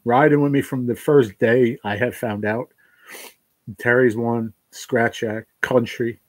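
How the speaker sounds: normal talking voice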